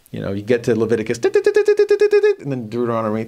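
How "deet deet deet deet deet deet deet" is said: rapid high, loud electronic beeps; ping away like a detector going off; mock gadget beeping; beeping meter noise. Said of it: "I am the Lord-o-meter".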